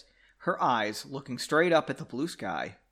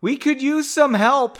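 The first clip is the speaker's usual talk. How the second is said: higher, louder call for help; 10 semitones higher, 8 dB louder than usual. A narrator's reading. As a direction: call it down to the travellers. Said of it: "she called".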